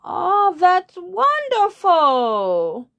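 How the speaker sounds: very high, very slow, loud coo; gushing lead bird. Acted. Lead bird cooing at the frog, high and slow. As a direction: gush over it. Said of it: "said the lead bird".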